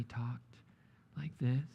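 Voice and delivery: imitating a plodding professor, long gaps between words; ponderously slow talker. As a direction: drag it out softly, word by word. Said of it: "He talked about thirty words per minute".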